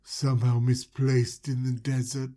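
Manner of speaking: creepy ruler voice. Retold or imitated. Joseph questioning the brothers, low and slow creepy voice. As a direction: loom over them, slow and low like a creep. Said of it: "He's like".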